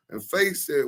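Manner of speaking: ordinary delivery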